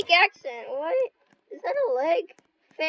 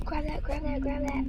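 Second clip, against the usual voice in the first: hurried hushed whisper; urgent whisper; 8 dB quieter than usual. Real, not acted. Urging a teammate to grab the item, an urgent whisper.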